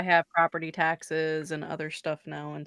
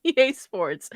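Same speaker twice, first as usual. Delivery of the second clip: slogan announcer; 5 semitones higher, 7 dB louder, slower than usual. Retold or imitated. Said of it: "That's the slogan right there".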